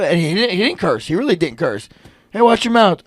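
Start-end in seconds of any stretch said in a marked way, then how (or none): 2.3-3.0 higher, louder mock reprimand; mock scolding. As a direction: call out a sharp warning, acted. Acting out a scolding of kid.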